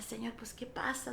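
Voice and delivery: very soft, half-whispered plea; low-volume, puzzled murmur to God; hushed questioning prayer. Retold or imitated. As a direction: ask it under your breath. Retells puzzled private prayer to God.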